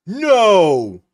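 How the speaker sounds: loud, high, outraged shouts; real outraged yelling. Real, not acted. Yelling at villain on screen.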